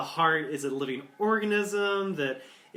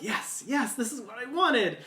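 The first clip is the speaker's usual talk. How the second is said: delighted director; 9 semitones higher than usual. Retold or imitated. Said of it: "the director's just like".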